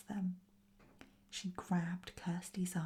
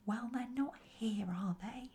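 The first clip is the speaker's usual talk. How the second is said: higher, mildly peevish grumble; grumbling; 4 semitones higher than usual. A narrator's reading. Goblin objects to the other's searching, grumbling. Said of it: "grumbled the other".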